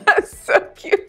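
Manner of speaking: high, breathy laughing. Real, not acted. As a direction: laugh through the words. Laughing after a joke.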